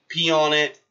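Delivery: usual speaking voice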